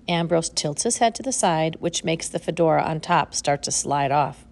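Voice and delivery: ordinary delivery, nothing marked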